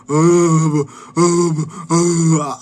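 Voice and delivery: loud, high halting "uh, uh" of a dim neighbor; mocking a dumb neighbor. Mimicking a Bulgarian neighbor to viewers, dim loud stammer. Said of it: "just hearing your".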